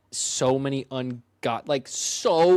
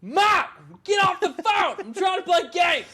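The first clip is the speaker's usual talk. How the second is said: high, loud, whiny yell; kid yelling; 3 dB louder than usual. Retold or imitated. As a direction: holler like an annoyed kid.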